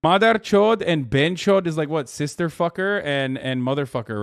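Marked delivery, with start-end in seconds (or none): 0.0-1.7 "Madar Chod and Ben Chod" pronounced loudly as Hindi; careful Hindi pronunciation. Shown as a demonstration. Streamer checking Hindi words with chat, careful Hindi pronunciation. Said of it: "trying to say words correctly".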